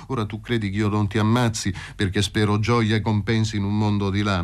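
plain reading voice

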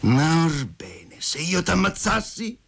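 drawn out, then pitched high; sudden cry. A narrator's reading. Lover suddenly threatens Masha with death, crying out. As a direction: burst out with a threatening question, rising high. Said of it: "gridò egli improvvisamente".

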